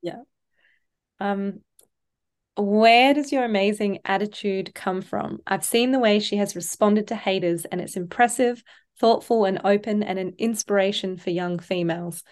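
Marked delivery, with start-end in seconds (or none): none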